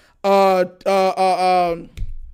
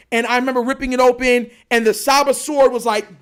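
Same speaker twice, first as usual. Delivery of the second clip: usual speaking voice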